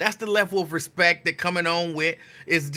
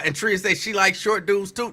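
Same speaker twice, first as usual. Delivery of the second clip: ordinary talk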